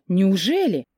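wide-swinging amazed question; astonished disbelief. A narrator's reading. Hoping her friend is the client, astonished disbelief. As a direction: ask it as if barely believing. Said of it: "охнула я".

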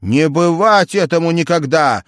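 loud defiant vow; defiant outburst. A narrator's reading. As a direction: raise it, refusing defeat. Джек решается из последних сил. (громкий решительный возглас)

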